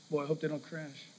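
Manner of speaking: a nervous thought kept to yourself; a parent fretting as the car pulls away; worried aside. Retold or imitated. Worried thought handing over keys.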